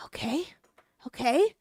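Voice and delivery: soft, rising okay; strained patience. Retold or imitated. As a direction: retell a mom holding it in. Mother holding back in retold call.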